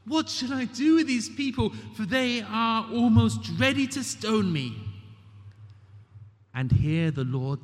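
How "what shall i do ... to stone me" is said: higher, pleading cry to God; desperate cry. Acted. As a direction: Moses at his wits' end begging for help. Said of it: "Moses cries out to the Lord".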